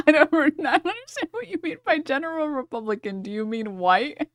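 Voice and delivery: high, quick, incredulous mimicry; mocking imitation. Mockingly repeats the debater just played.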